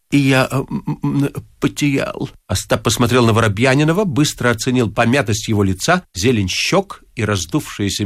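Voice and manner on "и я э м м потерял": hesitant, broken-up admission; guilty stalling with mumbled fillers; stammered confession. A narrator's reading. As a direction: hem and haw before confessing.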